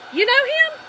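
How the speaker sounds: high, loud disbelieving husband; incredulous question. Retold or imitated. Husband asks wife about a stranger, incredulous. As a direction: pitch it way up in mock disbelief.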